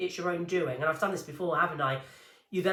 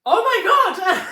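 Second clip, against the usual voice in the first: slow, high, loud laughing oh my God; stretched-out laughing shriek; stunned gasp; 14 semitones higher, 13 dB louder, much slower than usual. Retold or imitated. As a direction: drag it out in laughing disbelief. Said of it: "I was like".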